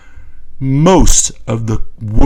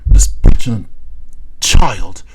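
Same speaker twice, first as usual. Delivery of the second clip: scornful whisper; 4 semitones lower than usual. Real, not acted. Critic whispering an insult about the president, scornfully. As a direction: hiss it like a disdainful secret.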